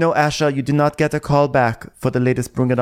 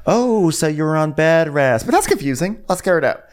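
higher, mock-sweet imitation; fake concern; 3 semitones higher than usual. Host mimicking an asshole way to start a conversation, fake concern. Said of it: "Sutton is so fake".

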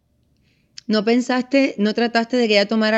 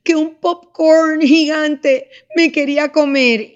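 put-on high, slow childlike voice, louder; little girl voice; 6 semitones higher, 5 dB louder than usual. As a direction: act the scared little daughter telling her dream. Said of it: "Entonces me dice". (voz de niña pequeña, aguda y lenta)

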